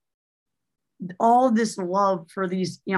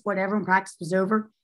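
ordinary talk

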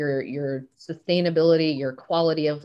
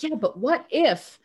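retold coaxing challenge; 7 semitones higher than usual. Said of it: "And I'm like".

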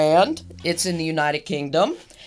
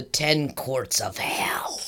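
slow, hushed whisper; spooky whisper; 6 semitones lower, 7 dB quieter, much slower than usual. Acted. Host naming the Ten Courts of Hell park in a spooky whisper. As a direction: drop to a creepy whisper.

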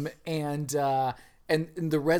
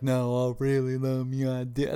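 lightly voiced, fading Southern pleading; a hint of a Southern accent. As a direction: plead in a half-hearted Southern lilt. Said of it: "That's not even Southern anymore".

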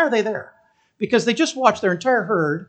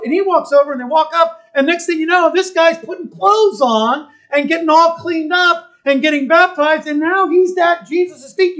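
normal talking voice

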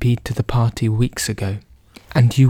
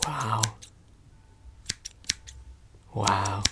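hushed wow; 16 dB quieter, much slower than usual. Acted. Reacting to haircut, very hushed.

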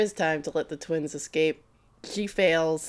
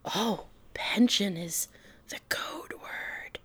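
secretive whisper, slower; 8 dB quieter, slower than usual. Retold or imitated. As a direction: hush it like a spy sharing a secret. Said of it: "Yamamoto's like".

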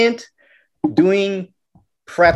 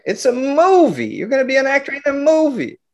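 put-on fuming kid; 9 semitones higher, faster than usual. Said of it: "I was fuming".

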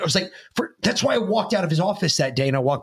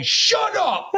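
high-pitched imitated hallway yell; mock yell down a hallway; retold yell; 13 semitones higher than usual. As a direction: call it out high, without full volume. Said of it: "I yelled down the hallway".

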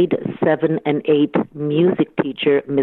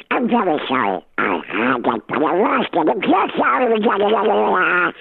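put-on childish excuse; 4 semitones higher than usual. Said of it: "I go".

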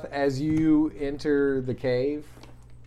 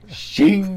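imitating a sharp metallic shing; blade shing sound; 8 semitones higher, 11 dB louder than usual. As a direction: mimic the blade sound, loud and laughing. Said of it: "It was just you going".

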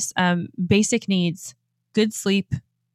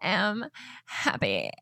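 soft, slow and timid, barely said; a limp, half-hearted "I am happy"; feeble affirmation; 3 semitones higher, 8 dB quieter, much slower than usual. Retold or imitated. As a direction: mumble it like you don't believe it. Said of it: "do you think being like".

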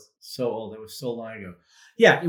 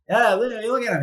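much higher and louder, sneering taunt; mocking tech CEO; 12 semitones higher, 9 dB louder than usual. CEO mocking the reporter during the interview, loud sneering jeer. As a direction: jeer at the reporter like a bully boss. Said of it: "he was like that".

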